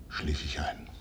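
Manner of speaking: breathless whisper fading out; hushed whisper of falling asleep; whispered drift into sleep. Acted. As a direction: let it sink into sleep.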